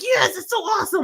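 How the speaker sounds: high-pitched burst of enthusiasm; inner excitement. Shown as a demonstration. [name] voicing how they feel on the inside, excited. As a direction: let the hidden thrill leap out high. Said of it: "even though on the inside".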